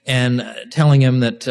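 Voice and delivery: normal talking voice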